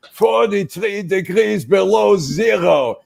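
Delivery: put-on high, slow, strained old man's voice; old Holocaust survivor. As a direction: play a frail old man topping the story.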